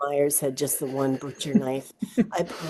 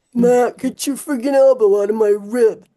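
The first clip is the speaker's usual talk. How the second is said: put-on louder, complaining teen voice; teen griping about an elbow; annoyed kid; 6 semitones higher, 10 dB louder than usual. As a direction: gripe at the boy beside you. Kid complaining in cramped hiding.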